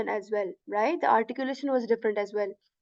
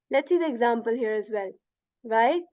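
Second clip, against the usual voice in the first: ordinary talk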